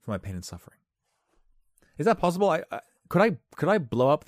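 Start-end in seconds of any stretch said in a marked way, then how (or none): 2.0-4.2 excited. Real, not acted. Excited rhetorical questioning.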